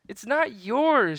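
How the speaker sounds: high, loud, slow protest; girl refusing the pirate; defiant girl. Acted. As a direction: refuse firmly.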